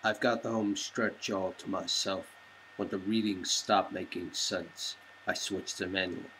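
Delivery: low, quiet first-person narration; brooding narration. Acted. Bruce narrating his race, low and brooding. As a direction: read it low and inward like a brooding hero.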